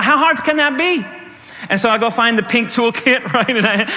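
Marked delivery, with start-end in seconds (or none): 0.0-1.0 breezy overconfidence. Acted. His overconfident thought before repair.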